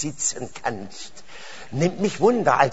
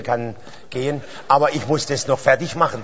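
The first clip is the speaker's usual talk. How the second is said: usual speaking voice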